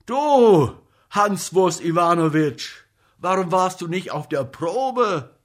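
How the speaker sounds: loud, high scolding attack; angry outburst. A narrator's reading. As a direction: pounce on him with an indignant shout. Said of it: "stürzte der Komiker auf ihn los".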